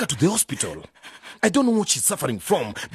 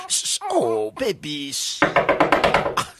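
hushing; 6 semitones higher, much slower than usual. Acted. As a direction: hush the baby gently. Hushing the baby.

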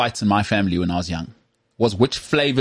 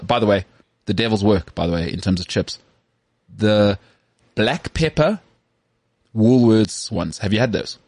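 ordinary delivery

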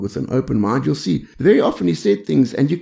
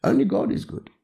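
gentle rebuke; 7 semitones higher than usual. Retold or imitated. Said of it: "says to him".

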